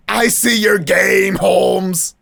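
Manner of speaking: slow, loud call-out; hammed-up, accusing shout; triumphant accusation. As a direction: call out the trickster, drawn out and loud. Hero calls out the detective.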